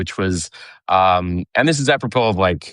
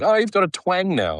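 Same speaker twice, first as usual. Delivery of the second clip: higher-pitched take on mom; mock mom's remark in a raised pitch; imitating mom; 11 semitones higher than usual. Mom teasing her son about his accent, imitated in a higher pitch. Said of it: "my mom's just like".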